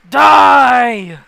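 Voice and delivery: high, drawn-out bellow at full force; huge menacing shout; ghostly shout. Acted. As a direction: roar it as a deadly command. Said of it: "And then you hear".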